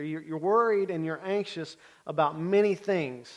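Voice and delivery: ordinary talk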